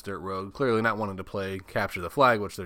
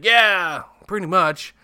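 loud, high offhand yes; blunt admission; 10 semitones higher, 11 dB louder, much slower than usual. Retold or imitated. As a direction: own it bluntly.

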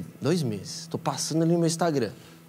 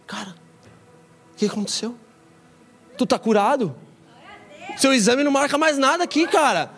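much higher, loud stunned disbelief; astonished doctor; 12 semitones higher, 4 dB louder than usual. Retold or imitated. Doctor telling the DJ his cancer is gone, astonished. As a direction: play a doctor who can't believe the results. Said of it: "E o médico falou".

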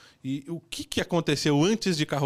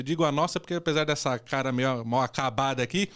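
ordinary talk, nothing marked

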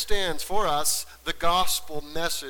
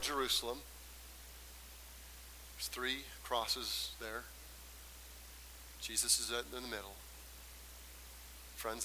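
very soft, low voice with long pauses; hushed scene-setting; 4 semitones lower, 16 dB quieter, faster than usual. Real, not acted. Preacher brings congregation to foot of cross, hushed. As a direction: quietly lead listeners into the picture. Guides imagining the crucifixion.

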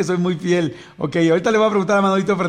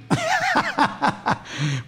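high burst of laughter; 5 dB quieter than usual. Real, not acted. Host laughing at himself after fumbling numbers. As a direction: laugh at giving up on the math. Laughs at own bad math.

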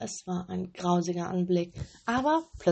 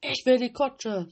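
higher, louder spoiled demand; willful robber girl; 6 semitones higher, 6 dB louder than usual. A narrator's reading. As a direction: insist like a stubborn child. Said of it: "sagte das eine Räubermädchen". (höher, lauter, trotzig fordernd)

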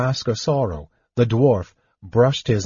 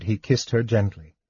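plain reading voice

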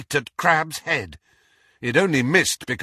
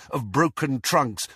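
ordinary delivery

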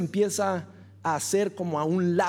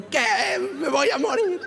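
higher, louder scared cry, played for laughs; mock panicked shout; 6 semitones higher, 3 dB louder than usual. Himself in the crashing car, mock panicked shout. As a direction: yell it like you think you're dying in the crash. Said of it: "Yo voy gritando".

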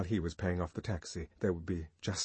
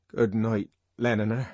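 strangled voice; 2 semitones higher, 5 dB louder than usual. Acted. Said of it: "he repeated".

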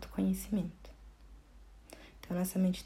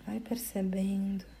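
guided meditation; 9 semitones higher, 4 dB quieter than usual. Acted. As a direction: guide the listener gently inward, phrase by phrase. (meditação guiada, voz pausada e tranquila)

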